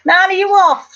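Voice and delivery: real call to someone heading out. Real, not acted. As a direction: call after her as she goes. Calling out to Annie.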